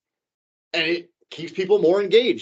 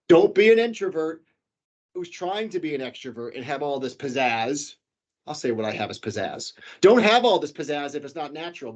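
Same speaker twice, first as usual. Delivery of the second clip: ordinary talk, nothing marked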